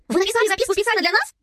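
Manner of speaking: high, astonished question; flabbergasted girl; stunned. A narrator's reading. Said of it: "ошарашенно спросила Билли".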